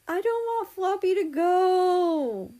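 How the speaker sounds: put-on high, slow, pouting childish plea; high, slow imitation of a pouting friend; mock whine. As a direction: drag it out like a sulking child. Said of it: "he was saying things like".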